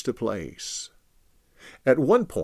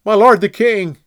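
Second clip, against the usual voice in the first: high, loud call across distance; David calling out to Saul from the cave; yelled; 10 semitones higher, 12 dB louder than usual. Acted. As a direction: shout after the departing king. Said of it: "David came out and yelled".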